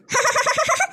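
high burst of laughter. Real, not acted. Wife laughs at the neighbour's twenty idli joke.

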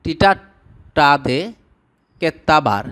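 টিটাটাদে drawn out very slowly, syllable by syllable; slow syllable demo. Shown as a demonstration. Teacher slowly modelling a Tamil word for viewers. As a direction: spell out টিটাটাদে slowly so learners catch each beat. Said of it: "গালি দেওয়া".

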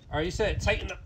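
ordinary delivery

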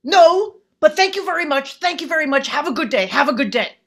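loud, high, emphatic polite refusal; aging folk singer. Retold or imitated. Aging folk singer turns down the mask request, loud mock cheerfulness. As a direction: imitate a chipper brush-off, easing down in pitch. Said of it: "the aging folk singer time traveler guy goes".

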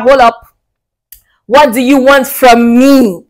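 wary man calling out flattery; suspicious challenge. Pretend. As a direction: stop her cold and demand the truth. Man confronts flattering woman.

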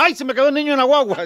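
very high, fast falsetto woman's voice; flustered woman. Acted. Topless woman explains to the bystander, high fast falsetto. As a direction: squeal it out as a flustered lady.

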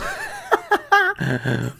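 high burst of laughter; laughing. Real, not acted. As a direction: crack up at your own impression. Cracks up at own impression.

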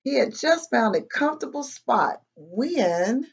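animated read-aloud with bouncy pitch; children's story narration. A narrator's reading. Reading a picture book to kids, lively storytelling. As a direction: tell it to little listeners, pausing before each mishap.

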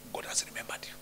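hushed whispered reassurance. Shown as a demonstration. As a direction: whisper it intimately.

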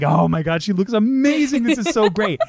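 high gushing delight breaking into laughter; squealing with glee. Retold or imitated. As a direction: gush with delight. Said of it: "And I was like".

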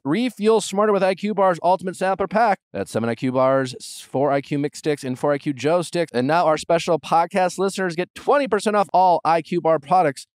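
polished promo announcer delivery; podcast ad read. Acted. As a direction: sell it clearly, every web address letter distinct. Reads sponsor ads.

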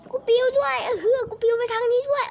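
loud frantic yell while fighting. Real, not acted. Frantic yelling during a game fight. (ตะโกนลนลาน)

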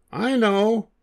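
high, bright monkey voice with an idea; little monkey's idea. Acted. One little monkey announcing a plan, high and bright. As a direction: pipe up like a clever little monkey.

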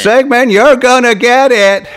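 high, loud Southern cartoon threat; Slurp. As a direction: taunt in a hammed-up Southern monster voice. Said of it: "Slurp had a Southern accent".

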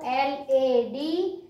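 very slow, letter by letter; slow spelled letters. Shown as a demonstration. Teacher spelling for young pupils, slow and deliberate. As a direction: spell each letter out slowly for the class. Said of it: "આ રીતે વાંચીશું".

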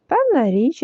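usual speaking voice

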